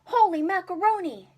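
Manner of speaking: very loud, high exclamation; boy's astonished yell; excited cry. Acted. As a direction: shout in amazement. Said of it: "Jimmy cried".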